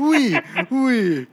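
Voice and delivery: tossing off a giggly French oui; mock French. Host answers the praise with a laughing French oui. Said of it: "that French accent was pretty good".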